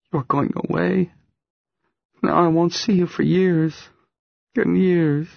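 high, upset, plaintive voice; plaintive, high-pitched crying complaint; tearful young woman. A narrator's reading. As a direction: lament it in a high voice through tears. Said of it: "starts to cry".

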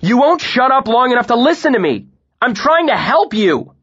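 very high, loud frustrated yell; desperate to be heard; strained shout of frustration. A narrator's reading. Shouting match continues.

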